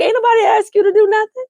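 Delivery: high, fast, sassy dismissal; sassy brush-off. Retold or imitated. Nicole telling her mother to back off, high and sassy. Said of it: "Nicole is like".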